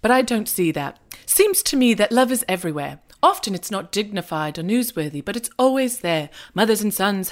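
a light, badly faked British accent; terrible Hugh Grant impersonation. Reading the film's opening voiceover in a mock British accent. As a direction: read it like a shaky stab at British. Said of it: "Excuse my terrible Hugh Grant slash shitty impersonation".

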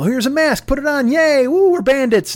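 put-on high, bright sing-song parent voice talking to a little kid; cheery parent to toddler. Dad to his three-year-old, cheerful high play voice. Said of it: "have that talk with your three-year-old and go".

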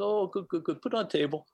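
put-on a cheerful old monk in halting English; imitating His Holiness. His Holiness pointing to the table, imitated high. He says.

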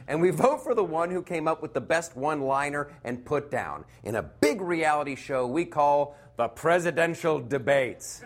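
usual speaking voice

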